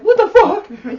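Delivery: very high, loud mock screech; hammed-up incredulous shriek; baffled victim. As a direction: yell it in bewildered outrage. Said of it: "he's like".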